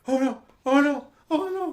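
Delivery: very high, loud terrified wail; panicked Hansel. Acted. Hansel wails in terror inside the cage, very high. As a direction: squeal in terror as if trapped. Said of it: "he's like".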